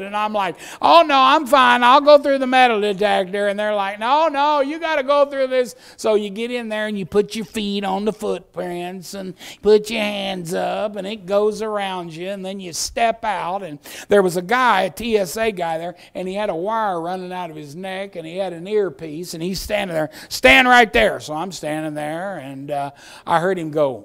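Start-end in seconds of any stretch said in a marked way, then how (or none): 0.8-3.5 high, loud polite refusal; hammed-up nervous declining; acted protest. 4.0-5.8 high, raised-voice official; hammed-up pushy guard; acted insisting. 20.3-21.2 loud, higher command; mock guard's command; acted order.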